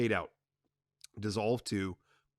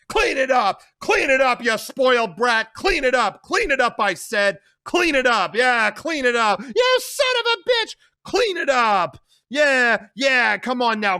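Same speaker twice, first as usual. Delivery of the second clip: much higher, loud, hammering; brutal shouting; 13 semitones higher, 10 dB louder than usual. Acted. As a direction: beat the order into her. Carlo beats Connie with belt.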